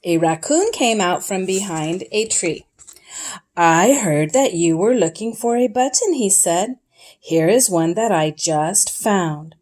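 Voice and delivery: gentle read-aloud storyteller voice; storybook reading. A narrator's reading. Parent reading Frog and Toad to a child, storytelling. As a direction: read it like a bedtime picture book.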